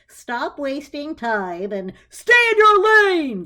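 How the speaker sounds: loud, slow road-rage yell; angry yell. A narrator's reading. Driver yelling at Clarissa for lane creep, loud and furious. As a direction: bellow it at a lane drifter.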